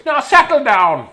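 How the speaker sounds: high, loud, slow order; stern teacher again. Acted. Correcting the sweet voice, still a stern teacher. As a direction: bark it at the class. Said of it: "That's not a sweet voice, is it?".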